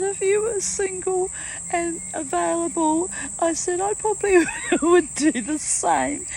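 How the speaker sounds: high, playful teasing flattery, laughing through it; hammy flirting. Retold or imitated. Flirting with Scott to make him laugh, high and playful. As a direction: ham it up with a flirty giggle. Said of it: "he knows how I always ham up with him".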